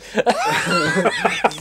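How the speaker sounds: high burst of real laughter; laughing fit. Real, not acted. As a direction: break out laughing. Bursting into laughter mid-confession.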